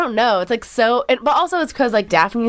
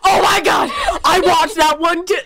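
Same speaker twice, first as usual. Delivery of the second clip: excited outburst; 9 semitones higher than usual. Real, not acted. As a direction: burst out in laughing, high-pitched recognition. Delighted outburst at friend's teasing.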